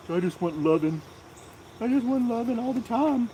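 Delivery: high pleading puppy voice; voicing the dog. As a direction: speak for the dog, begging for affection. Voicing the dog's wish.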